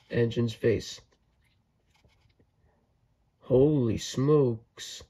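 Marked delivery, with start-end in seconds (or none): none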